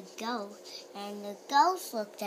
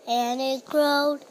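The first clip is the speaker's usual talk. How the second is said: loud; 9 dB louder than usual. Acted. Story building repetition.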